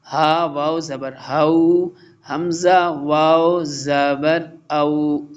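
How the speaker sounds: slow careful recitation, तऊ said short, not stretched; short vowel recitation. Shown as a demonstration. Teacher reciting slowly for the students, keeping the vowel short. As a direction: chant each letter combination slowly with a short ending. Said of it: "last line पढ़ लेते हैं".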